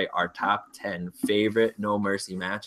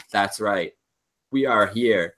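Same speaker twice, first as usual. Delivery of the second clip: ordinary delivery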